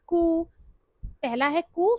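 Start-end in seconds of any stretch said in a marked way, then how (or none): 0.1-0.4 कू with ऊ held long and raised; long vowel. Shown as a demonstration. Teacher making it a long sound, कू stretched. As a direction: draw कू out, higher. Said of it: "इसको long sound बनाना है".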